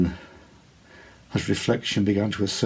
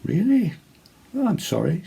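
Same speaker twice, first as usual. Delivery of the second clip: lady's voice; 11 semitones higher than usual. A narrator's reading. Lady's reply in story.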